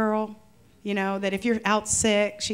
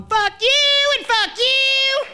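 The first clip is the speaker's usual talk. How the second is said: high, loud, slow parting shout; quitting rant; 13 semitones higher, 7 dB louder, much slower than usual. Retold or imitated. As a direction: yell it walking out the door. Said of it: "I walked out the door going".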